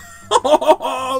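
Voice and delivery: high shouted burst of laughter; loud laugh. Real, not acted. Player cracking up at the landslide vote, loud laugh. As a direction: howl with laughter.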